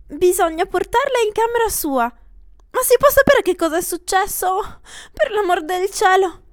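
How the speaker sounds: high, loud flustered aunt; agitated aunt demanding answers; fussing aunt. Acted. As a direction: fuss and fret aloud.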